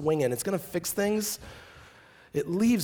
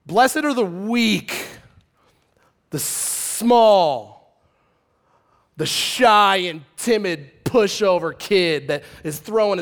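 mocking paraphrase; 7 dB louder than usual. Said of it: "mock verse twisted to weak".

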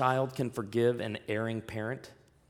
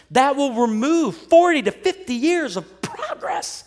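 mocking objector; 10 semitones higher, 10 dB louder than usual. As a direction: play the offended critic, shrill and shouting over the crowd. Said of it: "Ted, I don't like this idea".

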